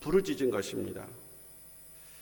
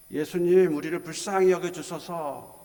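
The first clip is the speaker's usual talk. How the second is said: high pleading call, loud at the start; imitated crying out; 4 semitones higher, 4 dB louder than usual. Lepers outside the village calling to Jesus, imitated cry. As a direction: cry out for pity from a distance. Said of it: "불쌍히 해달라고 소리친 겁니다".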